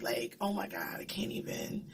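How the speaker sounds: breathless whisper, overcome; hushed, stunned whisper; awed whisper. Real, not acted. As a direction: whisper it as if the scene took your breath away. Overwhelmed recalling an Avenger's death.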